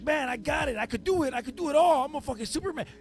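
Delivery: pitched high, cocky and eager; hammed-up overconfident bragging; cocky boast. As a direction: brag like you can handle everything.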